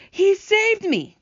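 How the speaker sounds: high, slow, loud exclamation; gasped amazement. Acted. Centipede just hauled up from the sea, amazed exclamation. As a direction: cry it out in overwhelmed relief. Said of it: "gasped the centipede".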